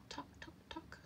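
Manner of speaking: soft, whispery "tock, tock, tock"; small hollow knocking noise; egg tapping. A narrator's reading. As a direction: tick it out softly like a tiny tap. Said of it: "went one of the eggs".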